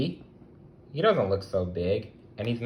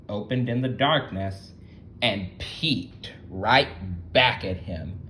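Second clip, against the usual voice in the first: ordinary delivery, nothing marked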